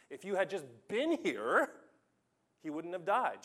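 loud, high, accusing cry; angry lament. Acted. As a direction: burst out at the Master in grief and fury. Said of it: "And she's angry".

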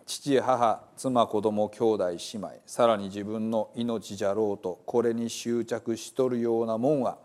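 Gospel told in lively Hiroshima dialect; dialect story reading. Acted. Reading the Bible to listeners as a dialect storyteller. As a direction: tell it like a hometown storyteller.

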